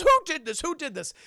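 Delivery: high-voiced indignant demand; mock indignation. Pranked girl confronts the classroom, high mock indignation.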